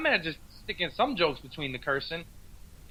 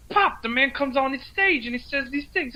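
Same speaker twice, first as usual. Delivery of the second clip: Cosby voice, pitched up; imitating Cosby, high voice; 6 semitones higher than usual. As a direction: do a raised Cosby voice. Cosby voicing son.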